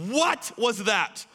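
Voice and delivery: high, slow, stunned question; astonished. Acted. Disciples who just saw the storm calmed, astonished. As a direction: stretch it out in loud disbelief.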